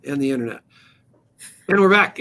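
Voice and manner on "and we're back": radio comeback. Real, not acted. Radio host returns after break.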